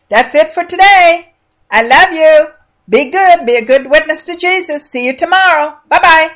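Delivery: high, sing-out goodbye to listeners; affectionate sign-off. Real, not acted. Storyteller saying goodbye till tomorrow, affectionate and high. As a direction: send them off fondly, pitched well up.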